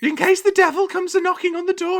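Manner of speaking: put-on high old wife's voice, rising to a loud warning; old woman voice. Cecily warning about the devil at the door, high old woman's voice. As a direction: speak like a fearful old wife, then cry out. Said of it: "And Cecily says".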